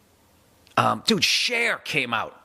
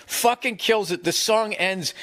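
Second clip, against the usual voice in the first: ordinary talk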